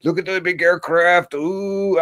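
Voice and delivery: put-on high, hushed gullible old person; mocking old viewer. Mocking old folks fooled by the news. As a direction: gawk like a fooled grandparent. Old people watching CNN.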